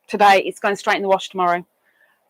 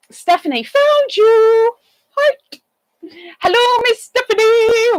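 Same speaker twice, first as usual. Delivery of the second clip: much higher, louder hello; high excited greeting; 12 semitones higher, 5 dB louder than usual. Real, not acted. Greeting a newly arrived viewer with a high excited hello. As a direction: call out her name brightly.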